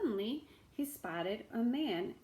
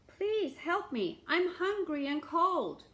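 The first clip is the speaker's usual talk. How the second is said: slow, higher plea; begging beggar; 9 semitones higher, 6 dB louder than usual. Acted. Beggar knocking at another house, begging. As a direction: beg to be let in.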